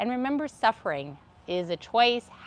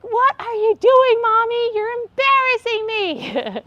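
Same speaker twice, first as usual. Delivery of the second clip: put-on much higher, louder, slow child whine; embarrassed kid; 13 semitones higher, 8 dB louder, slower than usual. Kid embarrassed by tantruming mommy, whining. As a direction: play the mortified child. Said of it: "the kids are like".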